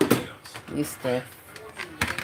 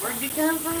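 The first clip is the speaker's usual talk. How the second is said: curious questioner; 9 semitones higher, 3 dB quieter than usual. Retold or imitated. Imitating people asking about accent.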